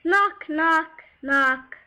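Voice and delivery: loud spoken knock knock. Acted. Narrator knocking at the wolf's door, loud.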